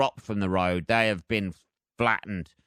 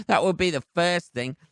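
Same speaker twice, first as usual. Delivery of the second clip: chuckling through the words, a bit higher; laughing; 5 semitones higher than usual. Real, not acted. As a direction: laugh while you say it. Laughs at own joke.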